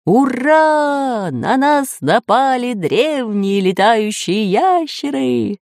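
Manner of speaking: high, drawn-out cheer; jubilant cry. A narrator's reading. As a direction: cheer the discovery, stretching the words. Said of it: "закричал профессор Пыхтелкин".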